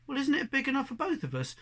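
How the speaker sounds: hopeful little cat asking to share; pleading young cat. Acted.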